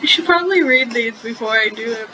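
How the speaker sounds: giggling through the words; real laughter breaking the line; laughing. Real, not acted. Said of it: "I'm laughing so much I can't do my voice".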